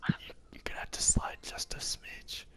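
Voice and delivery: tense hushed whisper; whisper, enemies close by. Real, not acted. Enemies right above them.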